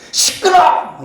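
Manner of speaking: high, sharp mock snap; preacher imitating the crowd silencing the beggar, high mock snap; mock angry crowd. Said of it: "이거죠".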